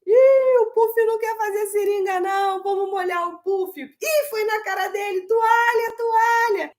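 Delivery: high, squeaky toy voice; a silly high cartoon voice at bath time; playful character voice. Shown as a demonstration. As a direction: voice the toy Puff playfully for a small child. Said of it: "principalmente com personagens, voz diferentes".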